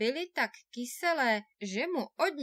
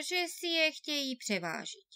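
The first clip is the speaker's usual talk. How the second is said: ordinary delivery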